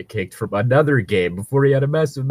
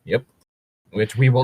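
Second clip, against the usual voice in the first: normal talking voice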